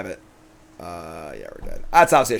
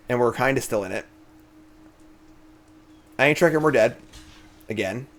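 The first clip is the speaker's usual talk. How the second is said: ordinary talk, nothing marked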